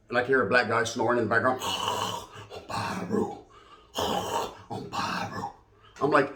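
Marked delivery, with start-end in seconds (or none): 1.6-5.4 breathless, voiceless, much lower; gasping whisper. Retold or imitated. A man hearing another man snoring, gasping in a whisper. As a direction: whisper it in shock between gasps.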